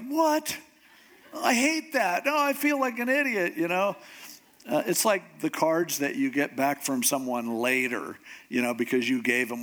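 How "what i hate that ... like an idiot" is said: high-pitched, embarrassed protest; mock dismay, voice jumping up high; dismayed disbelief. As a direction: react as if shown up by a gift. Acts out receiving too costly gift.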